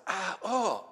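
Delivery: high startled ow of pain; sharp little cry of hurt; pained gasp. Shown as a demonstration. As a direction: yelp as if your toe was stepped on. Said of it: "to respond and say".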